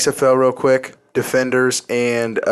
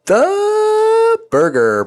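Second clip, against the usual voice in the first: drawn-out high announcer call; 4 dB louder, much slower than usual. Pretend. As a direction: stretch the nickname like a ring announcer. Hype nickname call.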